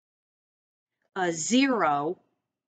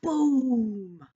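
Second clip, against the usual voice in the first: booming again; 4 semitones higher, 4 dB louder than usual. Shown as a demonstration. Teacher repeating boom for a child with feeling, a loud boom. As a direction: boom called out loud and high. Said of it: "say it with feeling".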